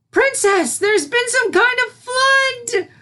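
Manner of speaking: very high, loud, slow alarmed cry; a mare calls out in panic. Acted. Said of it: "cried a mare".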